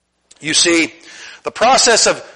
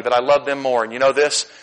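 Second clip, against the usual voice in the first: ordinary talk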